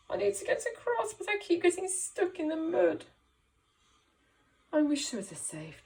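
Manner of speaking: high, loud plaintive dog; dog's voice. Acted. Teacher voicing the stuck dog in the story, high and plaintive. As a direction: play the sad stuck dog. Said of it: "said the dog".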